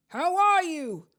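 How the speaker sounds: loud, high, drawn-out greeting; hearty roar. A narrator's reading. Headless huntsman hails his fellow ghost, roaring. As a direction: boom it out slowly and heartily. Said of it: "he roared".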